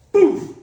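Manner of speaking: loud, high poof sound effect; mock explosion. Pastor acting the fire from the rock, loud mock burst. God showing his power.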